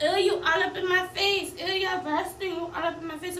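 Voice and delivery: put-on disgusted girlfriend. Girlfriend scoffing at the woman in her face, loud and disgusted. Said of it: "She was like".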